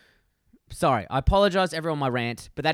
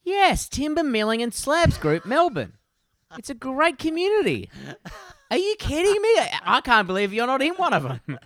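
mock snooty enthusiast; 8 semitones higher than usual. Teasing his friend in a hammed-up enthusiast voice. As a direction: ham up the outraged hobby snob.

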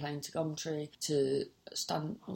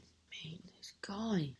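whispered, softer aside; exasperated whisper; 5 dB quieter, slower than usual. Real, not acted. Whispering her exasperation at the seller to the listener. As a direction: drop to a whisper in disbelief.

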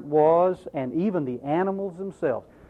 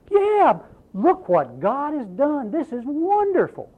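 high, bright, overjoyed prophet; mock delight; 9 semitones higher than usual. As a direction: gush with exaggerated joy. Imagined happy prophet.

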